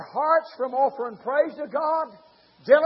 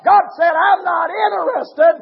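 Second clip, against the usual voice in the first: shouted sermon, building higher and louder; fiery preaching; 5 semitones higher, 11 dB louder than usual. Acted. Preacher thundering at his congregation, full-force preaching.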